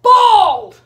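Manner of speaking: high, booming shout; calling out. Acted. Granny calls for someone, high loud shout. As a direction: holler it out long. Actress repeats the called-out line.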